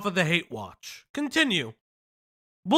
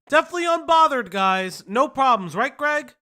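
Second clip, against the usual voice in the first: sarcastic; 5 semitones higher, 5 dB louder than usual. Acted. Mocking Johnny being bothered.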